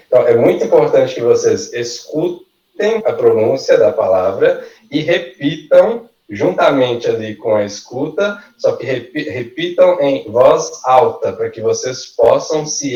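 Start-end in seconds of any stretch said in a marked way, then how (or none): none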